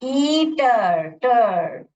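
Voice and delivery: loud, slow "ईटर", then "टर" alone; emphatic sounding-out of "ईटर, टर"; syllable division. Shown as a demonstration. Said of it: "यहाँ पर करना चाहिए".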